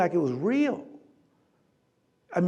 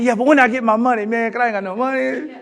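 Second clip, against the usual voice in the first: cheeky question; 5 semitones higher, faster than usual. Retold or imitated. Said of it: "And I said".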